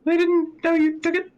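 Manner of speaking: high, incredulous noblewoman; high lady voice. Acted. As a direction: ask it in disbelief.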